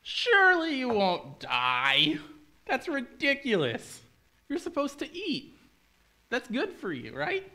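very high, drawn-out scoffing snake. Acted. Snake dismissing Eve's fear of dying, high scoffing voice. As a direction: stretch it out high like an incredulous tempter. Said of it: "And the snake goes".

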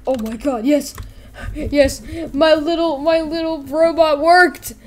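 high, loud triumphant exclaiming; elated. Acted. Creator thrilled the robot worked, loud elation. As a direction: crow over a success.

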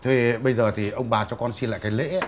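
low, soft, polite request; humble deferential plea. Acted. Young man humbly asks the elders to return the gifts, low and soft.

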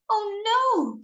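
high, drawn-out storybook "oh no"; puffling's high wail of alarm; dismayed cry. Acted. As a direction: cry out in acted dismay, pitch up then falling. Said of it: "cried the puffling".

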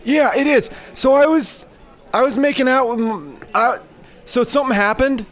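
high, loud silly guy; squeaky nervous customer; high goofy customer voice. Pretend.

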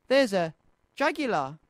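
ordinary delivery

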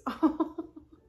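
a bright, high burst of laughter; delighted laugh. Real, not acted. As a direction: laugh out loud with joy. Overjoyed by a gift note.

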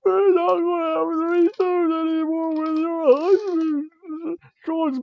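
high, drawn-out fake bawling; hammed-up sobbing apology, stretching the words; mock wailing. Wrestler apologising to her slapped partner, exaggerated high wail. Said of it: "She's like".

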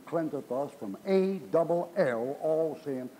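slow, deliberate "A double L", then "all"; measured stress on "A double L"; emphatic spelling. Retold or imitated. Spells out the key word.

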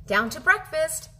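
usual speaking voice

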